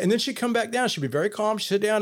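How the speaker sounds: usual speaking voice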